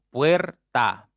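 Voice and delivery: "Puerta" split into puer - ta, both loud; syllable by syllable. Shown as a demonstration. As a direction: punch out "Puerta" as two beats, puer, ta. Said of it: "ya tienen dos sílabas".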